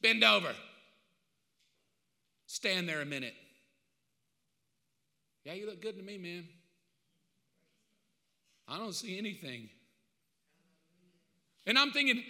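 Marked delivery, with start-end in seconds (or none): 2.5-9.7 low, soft, unhurried verdict; calm acceptance, barely above a murmur; gentle quiet God. Acted. God quietly looks Mark over, gentle and soft.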